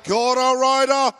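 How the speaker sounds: "Kia ora" in a Maori accent. Shown as a demonstration. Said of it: "Can you please use your Māori dialect?".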